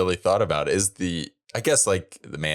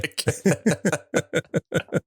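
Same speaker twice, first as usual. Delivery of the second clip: drawn-out ironic praise; 11 semitones higher, much slower than usual. Acted. Friend mocking famous fairy tales, slow ironic gushing. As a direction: stretch it very slowly and high.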